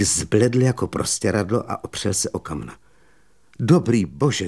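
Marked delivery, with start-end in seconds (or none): none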